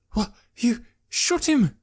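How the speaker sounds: shocked, high, slow stammer; stunned, words stumbling out. Acted. Jekrom, shaken, stammers at Malik in disbelief. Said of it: "he stammered".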